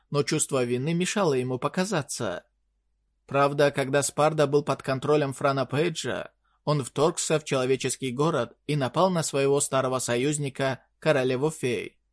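ordinary delivery, nothing marked

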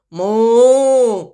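loud, drawn-out moo; cow's moo. A narrator's reading. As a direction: moo out like a startled herd. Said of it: "закричали все коровы". (протяжное мычание)

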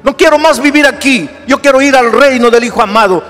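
high, loud impassioned sermon; preaching at full force. Acted. Pastor urging a hurting listener to accept Jesus, fervent preaching. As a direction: preach it out, rising to the rafters.

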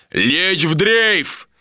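high, drawn-out command yell; bellowed order. A narrator's reading. Captain orders the ship to drift, bellowed high and slow. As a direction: bellow it slowly across the deck. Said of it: "проорал Иштар".

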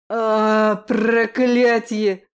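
loud, drawn-out curse of pain; angry curse. A narrator's reading. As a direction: spit it out furious.

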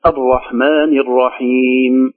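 slow, drawn-out الرحمن الرحيم on a steady tone; measured Quran recitation. Shown as a demonstration. Teacher reciting the verse to be taught, measured recitation. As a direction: recite with long, held vowels.

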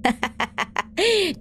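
high, soft crazed cackle; a wicked old woman cackling; cackling chuckle. Acted. Old woman over the ruined shrine, high crazed cackle. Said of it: "cười lên khùng khục".

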